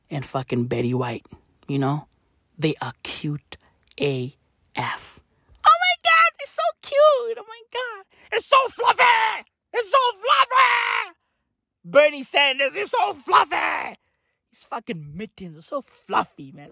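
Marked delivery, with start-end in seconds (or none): 2.6-5.2 whisper. Acted. Gushing aside, hushed. 5.7-11.2 squealing gush. Acted. Gushing over fluffy mittens. 11.8-14.0 squealing gush. Acted. Gushing continues.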